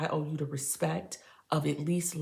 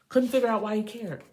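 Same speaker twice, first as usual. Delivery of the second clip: higher and louder, emphatic; loud repeat; 6 semitones higher, 5 dB louder than usual. Acted. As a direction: declare it out loud. Repeats the whispered line loudly.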